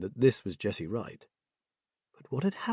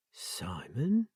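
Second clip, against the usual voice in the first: breathless whisper of the name; 6 dB quieter than usual. A narrator's reading. Jessie hearing the boy's name, whispered. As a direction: murmur it, blank and lost.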